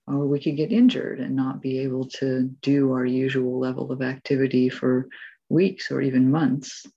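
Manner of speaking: ordinary talk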